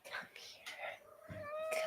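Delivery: whispered coaxing call. Real, not acted. Coaxing her cat over in a whisper. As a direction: breathe it to lure the cat closer.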